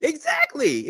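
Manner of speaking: sudden high squeal of agreement; delighted exclaim. Real, not acted. Cohost caps the Thanksgiving joke with a high delighted squeal. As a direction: shoot way up in pitch, loving the joke.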